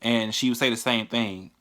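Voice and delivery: normal talking voice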